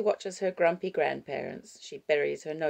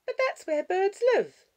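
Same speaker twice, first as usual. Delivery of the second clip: put-on high, loud little-girl voice; protesting child; 7 dB louder than usual. Six-year-old arguing with grandmother about birds, protesting child voice. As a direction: object as a small child, pitched right up.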